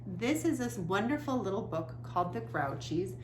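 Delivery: usual speaking voice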